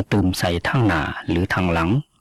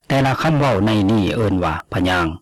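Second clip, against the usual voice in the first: ordinary talk, nothing marked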